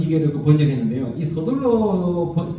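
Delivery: usual speaking voice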